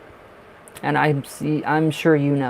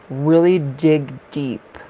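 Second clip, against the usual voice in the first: slow, louder, drawn-out urging; slow mystic urging; 6 dB louder, much slower than usual. Pretend. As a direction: coax them to dig deep, slowly. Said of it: "Dig deep where he is".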